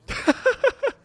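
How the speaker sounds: high loud cackle; a sudden squealing laugh; burst of laughter. Real, not acted. Gamer cracking up at a silly costume, high laugh.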